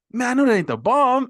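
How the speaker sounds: high, loud mock panic; loud retold incredulity; alarmed disbelief. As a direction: blurt it in comic alarm, imitating a film character. Acting out character's alarmed film line.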